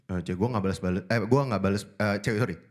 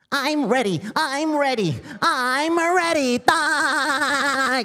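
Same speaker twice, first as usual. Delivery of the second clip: climbing higher and louder, very stretched; weird voice; 13 semitones higher, 3 dB louder, much slower than usual. Pretend. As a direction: build it up into a squeaky frenzy. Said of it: "impersonate suara aneh-aneh". (suara aneh makin tinggi dan keras)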